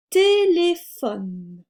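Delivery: TÉLÉPHONE slow, high and loud, falling on the last syllable; slow dictation. Shown as a demonstration. Teacher dictates the second word, slow emphatic dictation. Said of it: "nous allons faire une dictée de mots".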